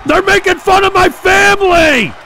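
high, loud indignant yell; outraged shout. Real, not acted. Streamer yelling at chat for mocking his family, outraged. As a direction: cry out that it's unfair.

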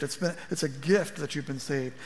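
usual speaking voice

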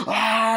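high, loud mock scream; startled scream. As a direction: let out a sudden cry of horror. Scream at masked attackers, retold.

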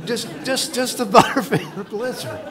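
laughing through the words. Real, not acted. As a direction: chuckle at your own joke. Jokes about the dessert.

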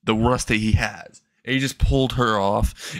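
ordinary delivery, nothing marked

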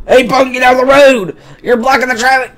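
high, loud police shout; bossy yelling. Acted. Police chief to a kid in the road, bossy yelling. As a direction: holler at a punk blocking the road.

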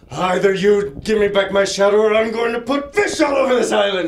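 high, loud hammed-up threat; mock villain ultimatum; menacing demand. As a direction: shout the threat at Hogback. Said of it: "he's like".

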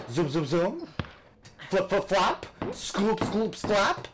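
squeaky playful narration of the moves; playful sound effects. Pretend. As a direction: make silly cartoon noises as you spin. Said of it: "little propeller action".

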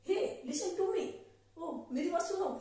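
high, drawn-out hey to stop someone; calling out hey. Shown as a demonstration. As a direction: call out to a passer-by. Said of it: "इस तरह जो बोलते हैं हम लोग".

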